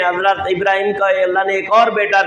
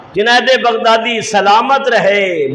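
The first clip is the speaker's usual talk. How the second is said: ordinary talk, nothing marked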